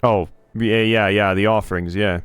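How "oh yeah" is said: normal talking voice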